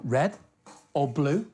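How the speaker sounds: ordinary delivery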